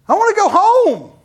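put-on high, loud childish whine; childish whining voice; whiny kid. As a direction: whine like a homesick teenager. [name] whining like child.

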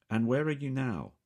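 guarded British man asking; cautious, clipped-free British query; British accent. A narrator's reading. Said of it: "The voice has a most definite British accent".

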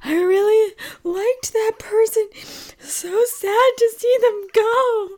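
high, slow, teary mock voice; imitating husband. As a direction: mimic a weepy husband. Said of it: "And he's like".

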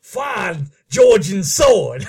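slow, loud hammed-up Southern command; a Southern colonel ordering his men in a hammed-up accent; mock Southern accent. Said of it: "that southern accent that we do".

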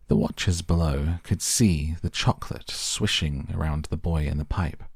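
usual speaking voice

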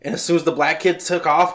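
ordinary talk

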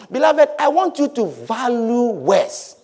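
ordinary talk

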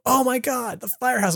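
high, hushed fanboy gush; mock amazement. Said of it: "I don't necessarily go".

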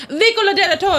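imitating the Italian cab driver calling out; mock Italian. Italian cabbie calling to other drivers, mock Italian. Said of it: "turns to two other cab drivers and goes".

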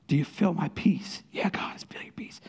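hushed exchange with God; whispered prayer. Retold or imitated. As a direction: whisper both voices softly.

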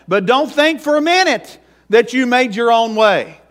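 high, loud revival preaching, pressing every line; fiery preaching. Acted. Pastor urging the congregation to accept Jesus, loud fiery preaching. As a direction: drive it home like a country preacher at full force.

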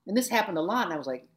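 normal talking voice

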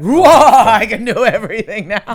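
laughing, excited and loud. Retold or imitated. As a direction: giggle through the boast. Quoting superhero feeling, laughing.

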